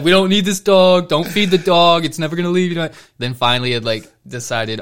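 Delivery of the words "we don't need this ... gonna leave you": a louder, higher dad grumbling; imitating dad. Dad grumbling that the family doesn't need a dog. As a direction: play the grumpy dad refusing the dog. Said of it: "he was like".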